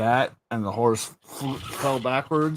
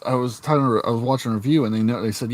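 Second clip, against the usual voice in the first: ordinary talk, nothing marked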